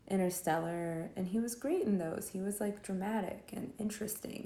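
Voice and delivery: ordinary delivery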